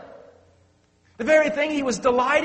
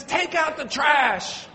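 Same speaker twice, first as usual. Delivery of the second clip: stern wife; 5 semitones higher, slower than usual. Retold or imitated. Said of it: "the Medusa look".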